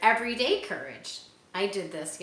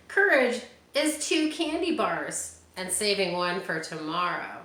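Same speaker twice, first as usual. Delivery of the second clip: ordinary talk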